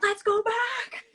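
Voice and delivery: high, slow mock-pleading mom voice; drawn-out high plea to head home; mom's panicked request, hammed up; imitating mom. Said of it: "she would've been like".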